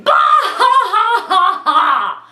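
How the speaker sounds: high, loud mock guffaw; a big theatrical bah-ha-ha laugh; hammed-up laughter. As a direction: imitate cracking up at the book. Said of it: "I kept going".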